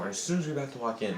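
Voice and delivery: normal talking voice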